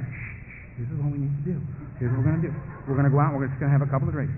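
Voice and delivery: hushing, low conspiratorial voice; low-pitched, sly persuasion; coaxing beast. Acted. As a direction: shush him, then coax him low. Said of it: "the beast whisper up and say".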